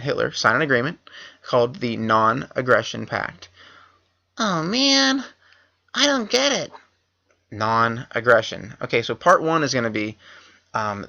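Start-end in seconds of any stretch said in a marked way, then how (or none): 4.4-6.7 much higher, squeaky put-on voice of a confused student; mock confusion. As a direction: play dumb in a high silly voice. Teacher feigns confusion at pact.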